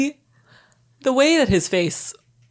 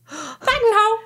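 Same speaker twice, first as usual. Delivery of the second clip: high 1930s lady; 13 semitones higher than usual. Retold or imitated. Gasped flower-girl imitation.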